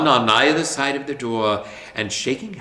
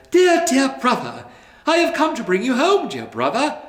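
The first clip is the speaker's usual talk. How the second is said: put-on high, bright-eyed child's voice; a small sister calling her brother home; little girl voice; 13 semitones higher, 4 dB louder than usual. A narrator's reading. Little sister coaxing her brother home, high and eager. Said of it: "said the child, clapping her tiny hands and laughing".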